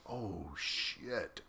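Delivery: barely voiced, sinking under the breath; hushed dread. Hearing the fill-it-in order, hushed dread. Narrator overhears commander's order.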